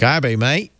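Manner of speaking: put-on Gabby said as kah-bay, mate as mite, Australian accent; light Australian accent on Gabby, mate; mock Australian accent. Host asked to say the sponsor name Australian-style, light Australian accent. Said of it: "How would you say it with an Australian accent?".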